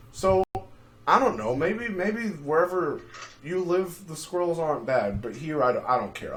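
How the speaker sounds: usual speaking voice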